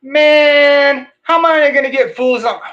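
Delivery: hammed-up retro slang character; mock hip guy. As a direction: play a jive seventies dude.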